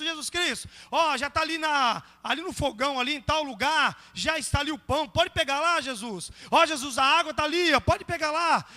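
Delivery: loud, high, hurried-off instructions to a guest; acted over-familiar shout to Jesus; offhand familiarity. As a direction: call out casually across the house.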